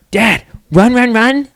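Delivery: put-on gasp, then high loud kid chant; high loud little-kid run run run; excited toddler. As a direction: imitate a thrilled toddler.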